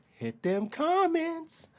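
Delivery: high, slow plea to comment; drawn-out call-out. Real, not acted. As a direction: stretch it out to the viewers. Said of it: "asking viewers to comment".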